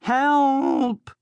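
very high, panicked cry; crying for help. A narrator's reading. Blood cries out as the troll rears up. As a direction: yelp it in terror. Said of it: "Blood cried".

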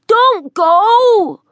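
high, loud calling after them; shouted plea. A narrator's reading. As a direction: call out, pleading. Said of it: "Ogden shouted after them".

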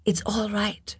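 soft, hushed reassurance; whispering. A narrator's reading. Lin comforts her collapsing servant, whispering. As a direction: soothe someone under your breath. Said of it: "she whispered".

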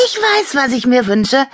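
high-pitched eager voice; high, keen little character voice; eager announcement. A narrator's reading. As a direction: announce a wish excitedly. Toy eagerly announces a wish.

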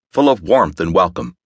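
usual speaking voice

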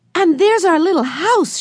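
put-on a small boy bursting with delight; excited little boy. A narrator's reading. Benny calling out to everyone from the boat, high and childlike. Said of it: "shouted Benny".

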